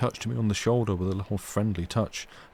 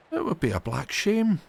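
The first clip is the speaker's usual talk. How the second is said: flushed outcry; 11 semitones higher than usual. Acted. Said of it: "she cried, flushing high".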